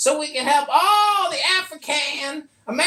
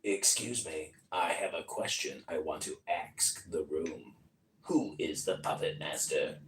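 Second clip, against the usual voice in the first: much lower, whispered creepy voice; 13 dB quieter than usual. Acted. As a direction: ask it like a sinister puppeteer. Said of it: "that was a creepy voice".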